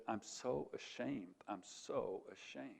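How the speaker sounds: slow, soft, broken with shame; tearful shame. Retold or imitated. Student after sobbing on the desk, slow and soft with shame. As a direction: let it drag out as if just done crying. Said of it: "sobbed and heaved".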